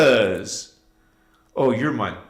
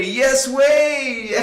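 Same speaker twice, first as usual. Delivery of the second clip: higher, shouted insistence; playful shout; 9 semitones higher than usual. Real, not acted. Streamer playfully insisting to a viewer, shouted. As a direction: insist it gleefully.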